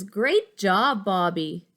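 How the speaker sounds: Miss Kirby praise. Acted. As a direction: congratulate him loudly. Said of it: "she says".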